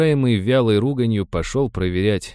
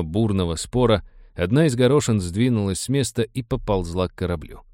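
ordinary delivery, nothing marked

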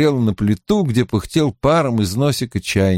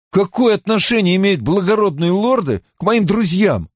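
higher-pitched, puzzled demand; pitched-up, indignant question; insistent challenge; 9 semitones higher than usual. Acted. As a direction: press her for an explanation. Mat challenges the woman's evasion.

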